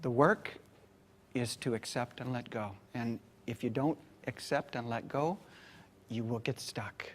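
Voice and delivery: soft, grave warning, fading to a whisper; very serious. Retold or imitated. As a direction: lean in and say it gravely, almost whispering. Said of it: "I said to them very seriously".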